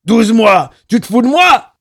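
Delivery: high, very loud angry bellow; raging, full-voiced shout; furious yell. A narrator's reading. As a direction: burst in yelling abuse. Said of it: "hurla le barbu".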